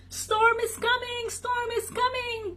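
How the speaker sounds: high-pitched alarm cry from a dog; urgent high-pitched barked warning. A narrator's reading. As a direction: sound the alarm, high and sustained. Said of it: "barked Doug".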